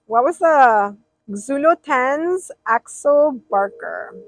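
ordinary delivery

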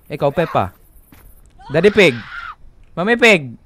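loud calling. Real, not acted. Player calling out creatures as Peppa characters, loud call. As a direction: point them out one by one.

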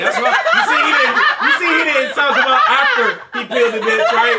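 high, loud laughing talk; cracking up mid-sentence. Real, not acted. Laughing hard while telling it.

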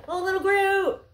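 high, loud squeal of delight; excited squeal. Real, not acted. Thrilled at the Groot toy.

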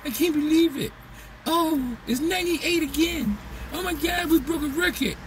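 high mock whine about the heat; hammed-up imitation of heat complainers; whiny complainer. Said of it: "for all y'all people that was".

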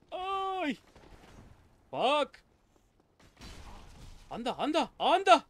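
high, loud startled yelps; startled shouts. Real, not acted. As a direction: caught off guard, yell in alarm. Startled by sudden enemy. (gritos de sobresalto, agudos y fuertes)

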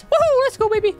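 very high, loud whoop of triumph; joyful whoop. Real, not acted. Player finding lost loot, cheering to viewers with a whoop. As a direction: cheer like you just won.

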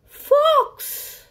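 high, loud gasp of alarm; gasp, then blurt it out scared; storyteller's shocked reveal; gasping fright. Acted.

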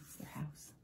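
soft whispered aside; hushed storytime aside. Real, not acted.

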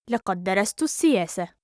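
quick, flowing and joined up; fast model sentence; natural speed. Shown as a demonstration. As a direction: run the phrase together fluently. Teacher repeats phrase at speed.

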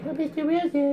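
high, loud mimicking yelp; mocking catchphrase. People who thought they were original teasing him, high mocking yelp. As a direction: squawk it like an unoriginal heckler. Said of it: "everybody thought they were so original".

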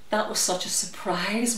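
ordinary delivery, nothing marked

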